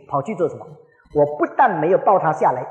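ordinary talk, nothing marked

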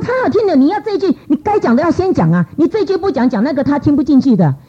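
urgent high insistence, reasoning with her; emphatic urging. Retold or imitated. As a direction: press the point home, pitch way up. Scolding sister's wrong words, pleading.

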